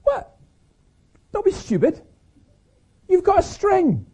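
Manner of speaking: put-on very high little-boy voice; scoffing little friend. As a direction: squeak disbelief at your boastful pal. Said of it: "his little friend says".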